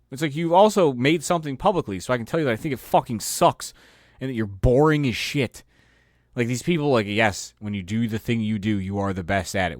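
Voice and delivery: ordinary talk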